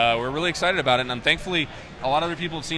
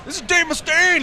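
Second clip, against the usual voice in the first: mock rock star; 5 dB louder than usual. Caller announcing himself in argument.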